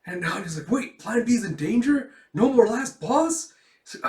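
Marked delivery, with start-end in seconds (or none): 0.7-3.5 high, loud, dismayed questions; mock alarm. Girl fearing her plan is ruined, mock alarm. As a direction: stretch the last question in hammed-up dismay.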